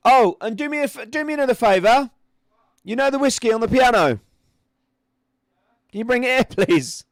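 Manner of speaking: high, loud call across the house; real shout. Real, not acted. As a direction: holler a favour to someone far off. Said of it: "Sorry to shout".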